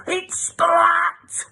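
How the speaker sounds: high, loud angry cry of her name; furious correction. Acted. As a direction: snap back the right name.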